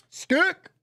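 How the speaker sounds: high, rising "Stick" to be heard; emphatic high repeat. Shown as a demonstration. Repeating the word for a friend who didn't hear, high and rising. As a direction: pitch "Stick" up sharply with a lift at the end.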